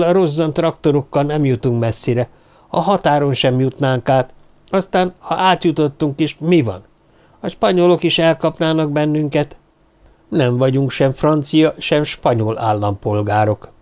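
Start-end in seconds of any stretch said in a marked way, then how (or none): none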